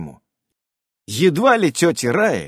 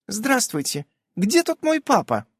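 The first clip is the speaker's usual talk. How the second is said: put-on girl asking; 10 semitones higher than usual. A narrator's reading. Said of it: "спрашивает она".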